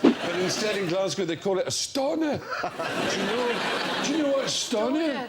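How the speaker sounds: put-on high-pitched comic Glaswegian accent; Scottish accent. Explaining Glasgow slang to the panel, mock Scottish accent. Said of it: "That's a Scottish accent, apparently".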